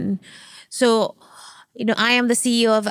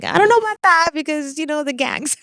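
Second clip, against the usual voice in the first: higher, louder doubtful quote of herself; playing her wary younger self; mock worried self; 6 semitones higher, 3 dB louder than usual. To her husband, doubting the gang name, mock worried. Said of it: "I was like".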